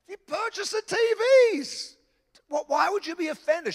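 squeaky exasperation easing down in pitch; incredulous squeal. Retold or imitated. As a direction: plead in comic disbelief, voice pitched right up. Incredulous argument with the absent woman.

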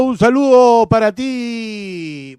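announcer greeting. Acted. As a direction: boom it like a radio host. Saludo teatral. (saludo de locutor)

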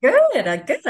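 high, loud, delighted good; excited good. Real, not acted. Healer cheering her client's insight, high and loud. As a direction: cheer the breakthrough.

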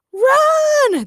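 very high, loud drawn-out shout; shouted warning. A narrator's reading. Fleeing couple to the five heroes, panicked shout. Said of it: "Daniel and Daniela shouted".

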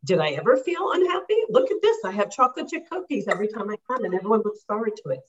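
high, fast, delighted; client's happy discovery, high and rushed; giddy realization. Retold or imitated. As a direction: burst out like you just realized you're happy. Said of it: "to the extent that".

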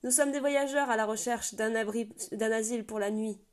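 loud hail to the gate above; calling out into the night. A narrator's reading. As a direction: call up to the sentry, loud and firm. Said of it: "appela-t-il".

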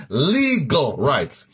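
"Legal rights" drawn out very slowly; slow, deliberate "Legal rights"; emphasis on legal rights. Shown as a demonstration. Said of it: "Very important I emphasize that word".

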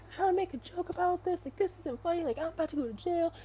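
imitated angry yelling. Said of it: "like, yelling at the one who looked it up".

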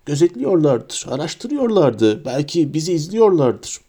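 usual speaking voice

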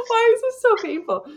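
normal talking voice

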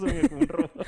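high, robotic imitation. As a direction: sound like a mic robot. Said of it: "¿Así te escuchas".

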